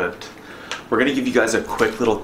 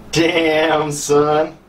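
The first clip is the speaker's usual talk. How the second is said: high, loud hype; pumped-up celebration; excited exclamation; 6 semitones higher, 4 dB louder than usual. Real, not acted. Reacting to weight loss.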